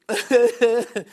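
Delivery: loud, high burst of laughter; real belly laugh breaking out; helpless laugh. Real, not acted. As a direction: crack up loudly. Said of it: "I haven't laughed like that for ages".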